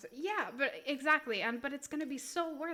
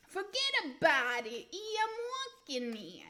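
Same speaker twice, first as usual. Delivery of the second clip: hammed-up Italian New Yorker, swooping high; exaggerated Italian-American New York accent; 4 semitones higher than usual. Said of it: "That's just Italian New York".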